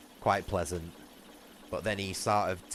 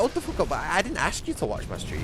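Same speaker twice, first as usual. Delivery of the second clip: higher and louder, fed-up outburst; angry retort; 9 semitones higher, 5 dB louder than usual. Retold or imitated. Retelling how he told off the viewer, an angry outburst. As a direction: snap back in disbelief. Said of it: "I'm like".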